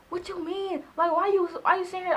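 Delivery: high, heated retold outburst; snapping. Girlfriend on the phone retelling how she snapped at her ex. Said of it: "I was really snapping".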